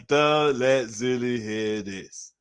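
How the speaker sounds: slow, drawn-out repeat. Real, not acted. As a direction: drag it out slowly. Repeated slowly.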